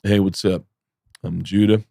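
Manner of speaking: low, deadpan stage introduction; understated mock-braggart comedian; Judah Friedlander. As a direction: lightly imitate a self-proclaimed champion, low and unimpressed. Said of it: "he gets on stage and just goes".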